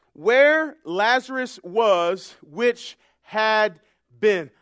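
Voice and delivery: loud, measured preacher's declaration; emphatic preaching. Acted. Preacher rejoicing over past tense verbs, loud emphatic preaching. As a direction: hammer out each phrase for the congregation.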